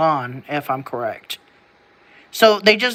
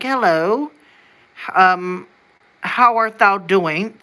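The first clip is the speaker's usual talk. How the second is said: mock old-time English accent; 2 semitones higher, slower than usual. Shown as a demonstration. Asking if early Americans spoke this way, English accent. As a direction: lightly put on an English accent. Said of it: "like a standard English accent".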